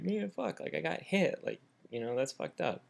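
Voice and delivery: high, mildly whimpering complaint; retold tearful upset. Man crying over being hit, high wounded imitation. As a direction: imitate being on the verge of tears, lightly. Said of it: "I kinda started crying a little bit".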